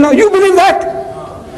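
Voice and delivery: high, slow disbelieving question; incredulous retort. Retold or imitated. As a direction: throw the question back in disbelief.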